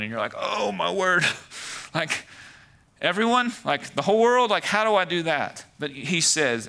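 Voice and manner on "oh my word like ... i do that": high-pitched, incredulous mock reaction; loud, exaggerated bewilderment; overwhelmed disbelief. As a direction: act out someone daunted, voice jumping up high. Acts out listener overwhelmed by verse.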